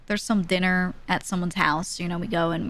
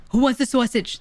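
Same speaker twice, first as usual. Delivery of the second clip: raised, higher impression of a yelling uncle; loud uncle's voice; 4 semitones higher, 4 dB louder than usual. Uncle offering sausage off the grill, loud yelling voice. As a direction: call it out like the loud man at the grill. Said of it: "His voice sounds like this".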